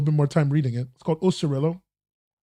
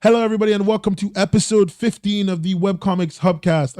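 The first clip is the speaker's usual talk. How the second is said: higher, louder podcast host intro; show-opening greeting; 5 semitones higher, 3 dB louder than usual. Real, not acted. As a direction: kick off the show brightly projected. Opens the show.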